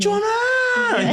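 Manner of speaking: long, high, loud "Chichona!" yelled with a laugh; Hmong parent's shout. Retold or imitated. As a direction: holler "Chichona!" like a mom hurrying her kid. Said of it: "we know about inflection".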